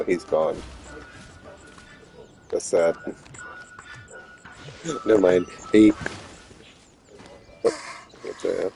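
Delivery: ordinary talk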